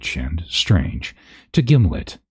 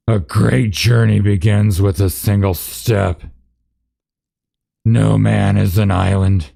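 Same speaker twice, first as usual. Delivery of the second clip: a hint of a grand elf lady, higher and a bit louder; lightly voiced elf queen; 4 semitones higher, 4 dB louder than usual. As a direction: say the proverbs like a lofty farewell.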